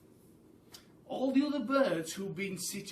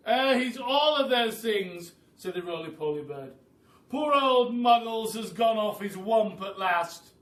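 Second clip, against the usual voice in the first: loud, higher rueful bird voice; roly-poly bird; 4 semitones higher, 10 dB louder than usual. Acted. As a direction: pronounce him properly mad. Roly-poly bird's sad verdict.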